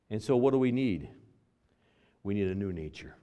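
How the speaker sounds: usual speaking voice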